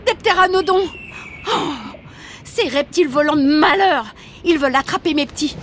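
protective mother shouting; alarmed outrage. Acted. Pteranodons attack her young.

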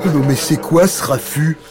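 hammed-up irritated dragon; dragon crying out. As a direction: roar at whoever woke you. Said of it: "cria le dragon".

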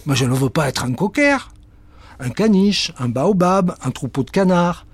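high, offended cat; hammed-up outraged cat; indignant cat. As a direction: reject the idea.

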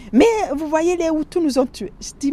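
ordinary talk, nothing marked

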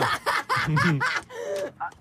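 high laugh, quick and breathy; giggle. Real, not acted. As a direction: laugh while waiting for the answer. Host laughs before Ilham answers.

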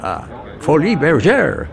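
lightly put-on Texan voice savouring "folie bergère"; imitating Jack Ruby. Ruby teasing the French reporter about Paris, mock American voice. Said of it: "qui me dit".

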